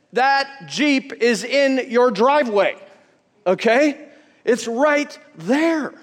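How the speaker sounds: preaching. Real, not acted. Pastor urging the congregation to believe, slow and insistent. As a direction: drive the truth home.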